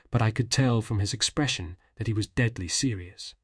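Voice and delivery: ordinary delivery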